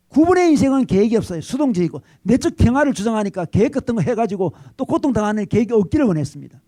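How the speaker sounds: ordinary delivery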